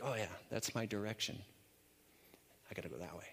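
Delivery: low, soft musing to himself; understated, hushed inner voice; quiet thinking aloud. Retold or imitated. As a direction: murmur it like a private realization. Acting out his own thought.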